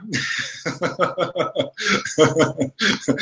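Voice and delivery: quiet giggle fit; soft giggling. Real, not acted. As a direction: laugh it off softly. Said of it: "сейчас я отсмеюсь немножко".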